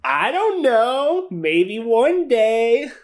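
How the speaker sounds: very high, drawn-out sing-song; coy teasing. Pretend. Game master hinting at future story to a player, coy and teasing. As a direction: play innocent in a slow squeaky voice.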